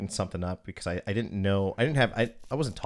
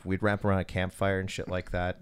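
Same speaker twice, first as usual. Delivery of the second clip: normal talking voice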